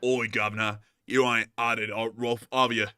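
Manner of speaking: lightly done Victorian Cockney; Cockney factory worker. Retold or imitated. Streamer riffing on the factory-worker bit, light mock Cockney. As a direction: play a rough London laborer. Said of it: "English factory worker from the mid nineteenth century".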